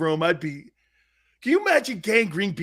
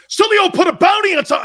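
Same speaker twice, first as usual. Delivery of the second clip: high, loud gossip voice; hammed-up outraged crowd; mock alarmed people; 14 semitones higher, 11 dB louder than usual. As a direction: ham up the shock. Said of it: "And everyone's like".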